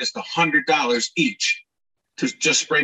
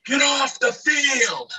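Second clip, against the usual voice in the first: distant shout; 12 semitones higher, much slower than usual. Retold or imitated. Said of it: "and I hear".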